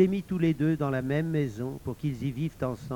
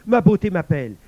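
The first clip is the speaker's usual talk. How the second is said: loud, raised-pitch cry; alarmed exclamation; 5 semitones higher, 9 dB louder than usual. Acted. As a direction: exclaim that she is calling you. Le frère bondit. (exclamation forte et alarmée)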